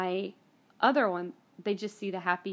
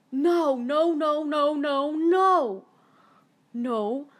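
higher, louder repeated cries of no; anguished denial; 7 semitones higher, 8 dB louder, much slower than usual. Retold or imitated. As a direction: cry out no again and again in shock. Reacting to her friend's death.

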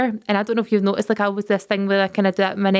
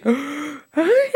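gasped, higher mock-yawned greeting; fake yawn; 6 semitones higher than usual. Co-host mimics Chris saying hello, fake yawning. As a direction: gulp air and yawn through the word. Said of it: "That's not even a real yawn".

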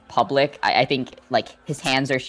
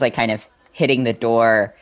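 normal talking voice

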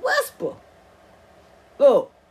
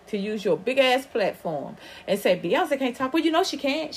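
ordinary talk

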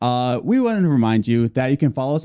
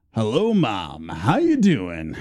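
lower, drawn-out greeting voice; special hello to Mom; 6 semitones lower, much slower than usual. Shown as a demonstration. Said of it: "I do have a request to say".

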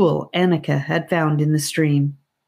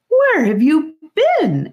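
high, slow, fretful aunt; worried aunt; 11 semitones higher, 4 dB louder, slower than usual. A narrator's reading. Aunt scolding lost children, high and worried. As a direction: scold out of worry. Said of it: "hot with worry".

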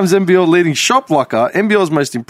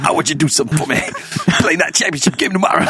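dying mentor; 3 dB quieter, much faster than usual. Pretend. Dying mentor in movie parody.

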